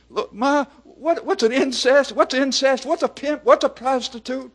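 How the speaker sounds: put-on high, loud little-kid voice; small child. Preacher voicing four-year-olds asking Ma blunt questions, small child. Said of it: "Kids four and five years old come into the house and say".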